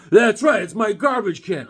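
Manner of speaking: loud, high, territorial retort; imitated angry neighbor. Imitating neighbor defending his garbage can, loud and indignant. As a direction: act out an irate owner insisting. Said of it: "This guy's angry".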